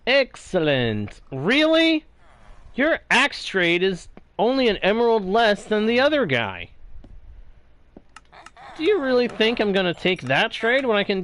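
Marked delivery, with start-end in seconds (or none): 1.3-5.6 surprised. Real, not acted. Reacting to the trade offer.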